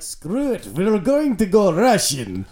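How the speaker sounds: hammed-up drunken Russian, pitched high and drawn out; horrible Russian accent. Host imitating a drinking-game accent, horrible drunken Russian. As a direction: do a terrible boozy Russian accent. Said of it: "a horrible Russian accent, but like you're also like incredibly intoxicated".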